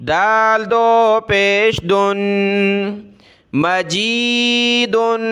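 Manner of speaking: measured sing-song letter-by-letter recitation; slow classroom recitation drill; Qaida spelling drill. Shown as a demonstration. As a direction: chant each syllable, then blend and stretch the word.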